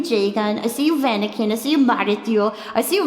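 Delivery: usual speaking voice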